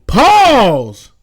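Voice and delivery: "pause" sung out high and loud with a long fall; drawn-out pause. Shown as a demonstration. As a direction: land hard on "pause", high, loud and stretched. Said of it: "You gotta say it like that".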